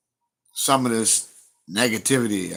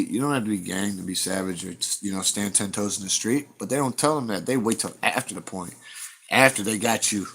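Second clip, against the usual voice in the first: ordinary delivery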